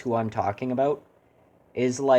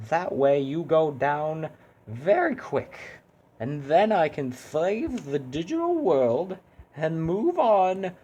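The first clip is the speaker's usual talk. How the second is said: higher, very slow, sing-song hero; cheery hero voice; 6 semitones higher, slower than usual. Acted. Hero tells Mister Pyramid Man how the fight will go, sweet and slow. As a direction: drawl it out sweetly while threatening. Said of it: "I can't do that voice anymore".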